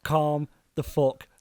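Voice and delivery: slow, drawn-out calm-down. Retold or imitated. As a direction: stretch every word to settle him. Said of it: "I'm just like".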